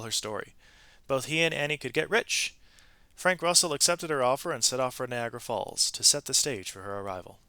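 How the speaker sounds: lively read-aloud storytelling voice; expressive storybook narration; story narration. A narrator's reading. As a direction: tell it like a picture book to children. Reads a picture-book story aloud.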